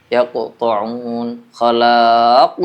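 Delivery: slow, yaqta'un with a light bounce and khalaq with a strong bounce; contrasting qalqalah. Shown as a demonstration. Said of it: "cara bacanya beda". (yaqta'un pantulan ringan, khalaq pantulan kuat, pelan)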